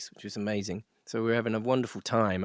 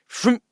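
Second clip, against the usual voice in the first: higher, louder mock engine roar; engine vroom; 10 semitones higher, 6 dB louder than usual. Imitating the plane pulling out of its dive, mock engine vroom. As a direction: make the plane rev back up.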